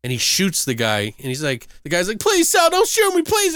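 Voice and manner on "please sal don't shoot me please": high, desperate begging; mock pleading victim. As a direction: beg for your life, voice up high. Victim begs again in retold scene.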